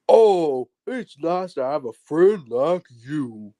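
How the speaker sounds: slow, friendly Southern bear voice; Brer Bear. Retold or imitated. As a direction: drawl it kindly like a big bear. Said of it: "Brer Bear actually sounds like this".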